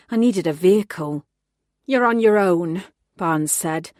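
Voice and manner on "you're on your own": curt angry. Acted. Boss cutting officer off.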